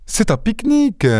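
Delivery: high surprised exclamation; exclaiming. Acted. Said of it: "m'écriai-je".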